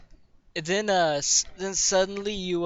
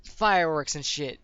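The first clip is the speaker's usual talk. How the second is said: normal talking voice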